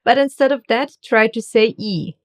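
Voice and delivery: usual speaking voice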